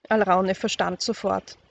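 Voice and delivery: ordinary delivery, nothing marked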